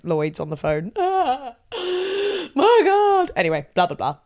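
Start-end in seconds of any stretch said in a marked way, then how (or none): 1.0-3.3 high gasping fake despair; mock distress. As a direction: gasp it out, all worked up. Acting out crying to the bank.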